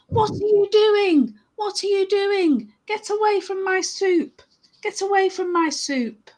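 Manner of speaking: high-pitched acted scolding; angry chef. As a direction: play an angry chef without extra volume. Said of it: "he got really angry".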